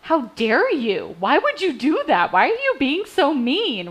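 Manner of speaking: hammed-up how dare you; mock offended outrage. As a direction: play the wounded, offended partner. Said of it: "my initial reaction is".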